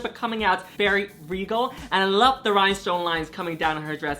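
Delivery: usual speaking voice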